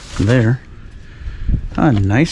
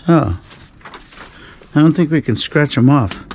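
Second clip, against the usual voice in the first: ordinary talk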